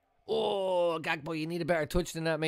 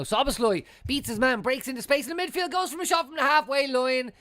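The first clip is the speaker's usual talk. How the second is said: excited commentary; 9 semitones higher, 4 dB louder than usual. Real, not acted. Live attack on screen.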